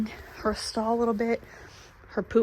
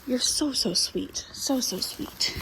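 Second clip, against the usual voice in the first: cooing; 2 semitones higher, 5 dB quieter, slower than usual. Real, not acted. Cooing at the mare.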